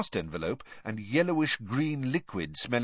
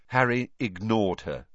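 usual speaking voice

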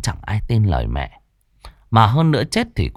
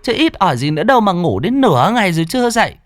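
put-on higher, fast nagging old mother's voice; scolding grandmother; 9 semitones higher, faster than usual. Grandmother scolding her oversleeping daughter, higher and nagging. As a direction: nag like an exasperated old mother. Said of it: "Bà ngoại trách".

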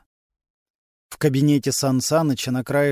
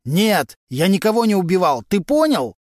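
loud, higher furious yelling; 6 semitones higher, 6 dB louder than usual. A narrator's reading. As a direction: scream it at the investigator. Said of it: "Валентин настолько громко кричал". (громкий яростный крик)

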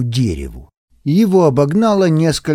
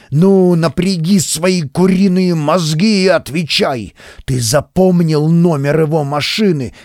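angry yelling; 3 semitones higher than usual. Acted. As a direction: tear into him at full volume. Officer berates lieutenant over field phone.

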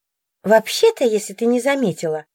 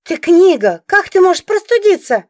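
incredulous exclaim; 9 semitones higher, 5 dB louder than usual. Acted. Heroine exclaims in disbelief.

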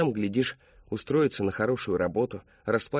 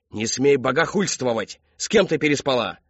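loud, raised angry voice; outraged shout; 4 semitones higher, 6 dB louder than usual. A narrator's reading. Father confronting daughter after slap, furious shout. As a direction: forbid and demand furiously.

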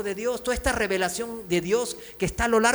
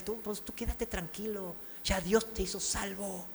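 mock reassurance; 11 dB quieter than usual. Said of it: "fanáticos que lo van a hacer".

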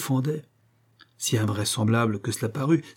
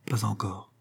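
soft, understated faltering reply; a small, timid admission; faltering stammer; 7 dB quieter than usual. A narrator's reading. Young woman confessing to her master, faltering softly. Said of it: "Madame Garitza balbutia".

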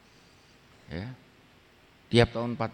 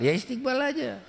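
brisk dismissive reply; 12 semitones higher than usual. Acted. Dai menjawab murid dalam adegan. (jawaban tegas yang meremehkan, nada tinggi dan keras)

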